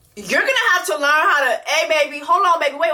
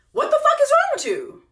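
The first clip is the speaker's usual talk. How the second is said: exasperated high outburst. Real, not acted. Reacting to show, rising outrage.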